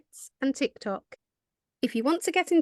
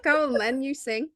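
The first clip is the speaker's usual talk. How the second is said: ordinary delivery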